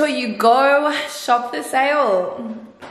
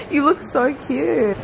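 ordinary talk

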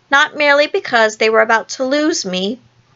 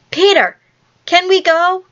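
high-pitched, eager plea; imploring cry; 8 semitones higher, 3 dB louder than usual. A narrator's reading. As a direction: beg for permission like excited children. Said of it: "they all cried imploringly".

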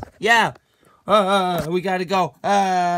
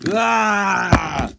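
startled cry; 4 dB louder than usual. Acted. Pulled into prank.

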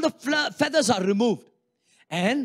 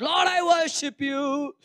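slow worship; 6 semitones higher, slower than usual. Acted. Acting a distracted worshipper praying.

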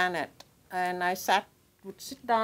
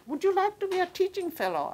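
quick, much higher voice for the professor's question; professor's offer; 10 semitones higher, faster than usual. Retold or imitated. Professor offering her a teaching post, quick and high-pitched. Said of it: "who said".

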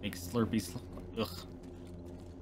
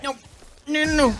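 panicked; 5 dB louder than usual. Real, not acted. Panicked at the spiders.

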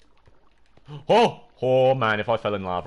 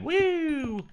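drawn-out high "woo"; high whoop. Real, not acted. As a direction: let out a happy whoop. Happy whoop while mining.

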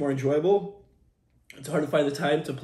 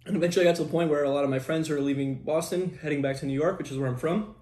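ordinary talk, nothing marked

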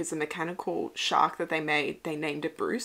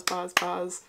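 usual speaking voice

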